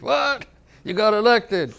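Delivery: very high, loud disbelieving with a laugh; hearing he's the new chief, very high in disbelief; astonished. Retold or imitated. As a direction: shoot up in shock and laugh. Astonished at being elected.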